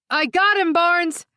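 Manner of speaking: loud urgent call; shouting. Acted. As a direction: call it out across the room. Officer calling out in the chaos.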